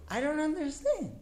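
high-pitched acted protest; baffled complaint, pitched right up; exasperated bewilderment. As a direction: play the stunned husband confronting his wife. Stunned husband confronts wife, acted.

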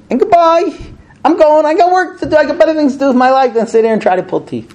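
high-pitched huffy goodbye, settling as it runs on; acted fed-up husband storming off; exasperated walk-off. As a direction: announce you're leaving, fed up. Acts out husband walking off.

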